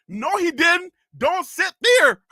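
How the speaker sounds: high, loud comic warning; emphatic warning. Acted. Host stopping tourists from sitting in empty car, emphatic. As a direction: cut in and warn them off.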